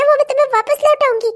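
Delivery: normal talking voice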